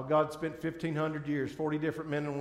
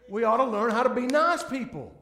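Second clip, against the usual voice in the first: Southern drawl held, loud and high; 8 semitones higher, 9 dB louder than usual. Shown as a demonstration. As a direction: keep the Southern drawl going. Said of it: "southern drawl on purpose".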